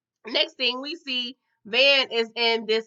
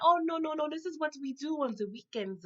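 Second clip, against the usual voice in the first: dismissive excuse; 4 semitones higher, 6 dB quieter than usual. Retold or imitated. Van brushes off the drugs.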